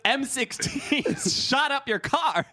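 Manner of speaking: high slow laughing emphasis. Real, not acted. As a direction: laugh through the point.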